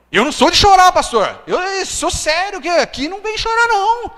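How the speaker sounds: high, loud, pleading against tears; retold tearful protest. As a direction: fight back the tears while insisting to the pastor. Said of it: "E eu chorava".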